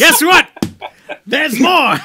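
loud, high pitchman yell; salesperson. Acted. [name] playing himself as a salesperson, big loud pitch. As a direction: hawk it like a showman. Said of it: "[name] as a salesperson is like".

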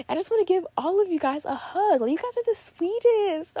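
a giddy, high, laughing gush. Real, not acted. Host gushing to listeners about a sweet comment, excited and squealy.

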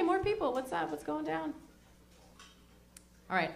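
ordinary talk, nothing marked

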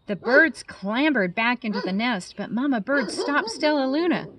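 put-on lively storybook narration for kids; reading to children. A narrator's reading. Biologist reading a bat story to children, animated. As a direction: read it like bedtime story time. Reading a picture book aloud.

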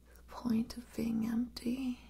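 ordinary talk

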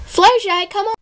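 calling out. Real, not acted. Calling her onto set.

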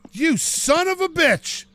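drawn-out outraged curse. Real, not acted. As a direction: stretch it out in disbelief. Looking at his damaged door.

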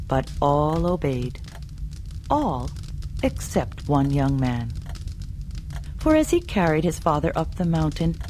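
usual speaking voice

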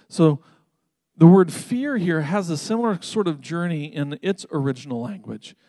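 usual speaking voice

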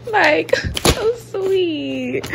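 slow, laughing so sweet; drawn-out, laughing; laughing fondly. Real, not acted. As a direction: gush about a kind stranger. Touched by kindness.

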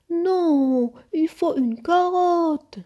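loud, high, drawn-out complaint; high, slow grouchy protest; grumpy objection. A narrator's reading. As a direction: grumble insistently, stretching the words. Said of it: "bougonna Nook".

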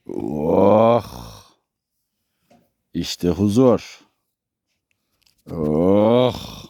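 low, slow, drawn-out sigh of peace; low blissful murmur, stretched out; contented sigh. Real, not acted. Relaxing among the animals.

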